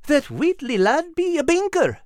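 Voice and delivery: high, eager little animal voice; Al's high voice. Acted. Al urging Nigel toward the bank, high and eager. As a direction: voice Al bright and high. Al explains, character voice.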